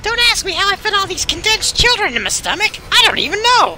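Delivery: very high, loud cartoon dragon; silly dragon voice. Dragon puzzled by its stomachful of children, shrieking high voice. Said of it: "I'm a dragon".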